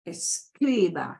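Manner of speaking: escriba drawn out slowly, weight on "cri"; stressed syllable shown. Shown as a demonstration. Teacher repeats it for the stress, slow escriba stressed on "cri". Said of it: "where you put the stress".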